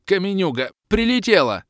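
loud high stunned cry; dazed babble. Acted. Dazed warrior on the ground, stunned cry. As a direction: gasp it out bewildered. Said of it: "путанно объяснил он".

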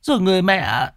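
very high, stammering, frightened voice; scared wife gasping out her story, pitch way up; panting, terrified wife. Acted. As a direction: stumble over the words in high-pitched panic. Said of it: "mặt cắt không còn giọt máu hổn hển".

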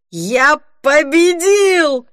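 high, loud, drawn-out shout of victory; triumphant yell. A narrator's reading. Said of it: "заорал он".

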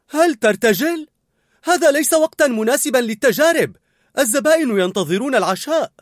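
high, loud panicked protest; alarmed. A narrator's reading. Cook to colleague while diners wait, alarmed. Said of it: "وسألته بفزع".

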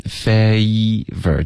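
FAVOR very slow with a full EE glide before the V; full EE sound. Shown as a demonstration. Teacher polishing a good attempt, slow full FAVOR. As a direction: fill out the EE in FAVOR, syllables apart. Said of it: "结尾的一的音再饱满一点".

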